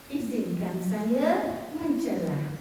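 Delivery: low, slow, measured request to cut in; polite interjection. Shown as a demonstration. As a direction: demonstrate courteously asking to interrupt. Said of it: "tak beradab".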